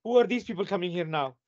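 ordinary talk, nothing marked

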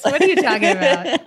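high, laughing protest. Real, not acted. Teased by friends, laughing protest. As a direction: laugh through the question. Laughing at her own joke.

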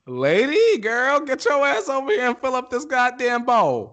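high-pitched bossy pet voice; demanding pet. Acted. Co-host voicing the pet demanding food, high and bossy. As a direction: order them about like an impatient animal, way up high.

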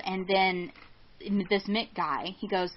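normal talking voice

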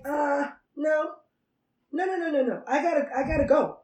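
high, rattled string of refusals; hurried begging off. Acted. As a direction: wriggle out and make your escape. Jesus begging off to be alone.

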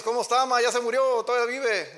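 high, loud, flippant sarcastic question; mock careless greeting. Preacher mocks a son's careless call to his mother, loud sarcasm. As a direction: toss it off like a child who doesn't care. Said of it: "no le dices".

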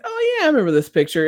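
mock recognition. Pretending to recognize a famous painting, quick and high. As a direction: feign remembering it. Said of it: "It's kinda like".

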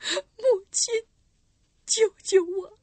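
high, tearful cry for help; anguished crying plea. Acted. Girl in her mother's arms, crying plea. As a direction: sob out a plea to be saved. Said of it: "痛苦地倒在隐修女怀里说".

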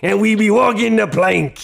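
loud pirate, laughing through it; hammy pirate teacher. Acted. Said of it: "here's [name]".

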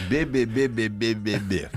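slow low blah-blah mockery; mocking nagging babble. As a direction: mimic a nagging wife's drone. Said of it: "семейный голос".

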